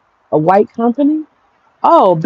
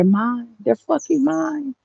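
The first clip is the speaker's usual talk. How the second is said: possessive gloat; 5 semitones higher, 9 dB quieter, much slower than usual. Real, not acted. Gloating over won clients.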